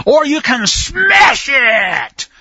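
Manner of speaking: shouted demo of the emphatic verb. Shown as a demonstration. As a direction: hammer the word smash home. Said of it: "you can break something".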